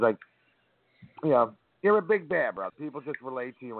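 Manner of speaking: ordinary talk, nothing marked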